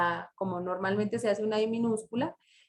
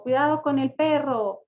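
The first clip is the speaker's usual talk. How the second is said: higher, louder warning with a falling end; exclaimed warning; 5 semitones higher, 5 dB louder than usual. Shown as a demonstration. Teacher giving an exclamation example, warning call. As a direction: call out the warning about the dog. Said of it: "hacemos la entonación".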